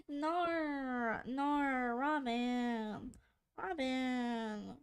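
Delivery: usual speaking voice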